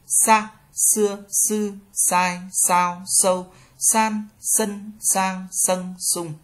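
"Sa, xưa, sư" with plain hissed s; high, even drill, each of "sai, sao, sâu" on a Vietnamese s. Shown as a demonstration. Teacher reading the S row, "Sa" with plain Vietnamese s. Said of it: "ét xê giống Việt Nam".

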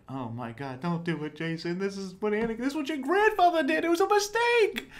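frantic fan begging a character; pleading outburst. Retold or imitated. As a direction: beg him not to fall, climbing to a cry. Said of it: "you're like".

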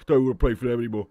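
low, fast, mumbling footballer impression; Harry Kane. Footballer explaining he wants to leave, fast low Harry Kane. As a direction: rattle it off low with rambling fillers. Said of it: "my name's Harry Kane".

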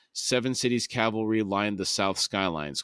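ordinary delivery

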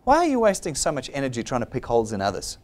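ordinary delivery

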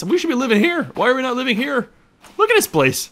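loud, high-pitched amazed exclaiming; excited outburst. Real, not acted. Player marvels at the island he could be living on, excited.

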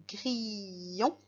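"grillon" slowly stretched on the i sound; drawn out for listening. Shown as a demonstration. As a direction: stretch "grillon" so each sound can be heard. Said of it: "j'écoute".

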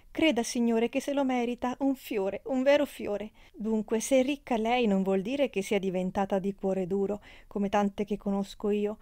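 a talkative circus friend flattering Leona; gushing chatty trapeze girl. Acted. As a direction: pour it all out to the gentleman. Amalia's gushing speech.

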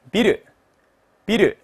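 ordinary talk

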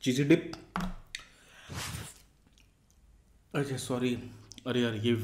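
talking while chewing; chewing food. Real, not acted. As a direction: mouth partly full. Seen 0: Eats from spoon just before; cheeks and lips show chewing early on.

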